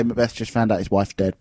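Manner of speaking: ordinary talk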